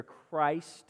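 Christ said as a full separate word; stress on Christ. Shown as a demonstration. Preacher showing the emphasized first word, stress on Christ. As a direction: give Christ its long i, standing alone. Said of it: "emphasizing those first wor".

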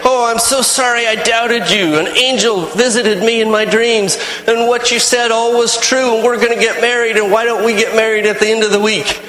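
eager fiancé begging forgiveness; happy apology. Retold or imitated. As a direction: rush to make amends, overjoyed. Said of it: "all apologetic and happy and nice".

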